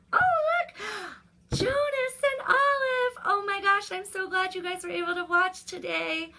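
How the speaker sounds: high, loud delighted squeal; thrilled hello to little friends; excited greeting. Real, not acted. Spots young friends watching.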